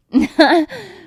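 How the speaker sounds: high, loud burst of laughter. Acted. Reacts to the spurs gag.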